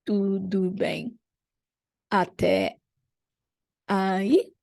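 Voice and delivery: "Tudo bem até aí?" said slowly with pauses between words; teacher's slow model of "Tudo bem até aí?"; slow, spaced out. Shown as a demonstration. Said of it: "Like this".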